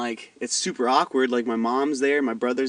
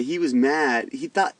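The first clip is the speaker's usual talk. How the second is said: usual speaking voice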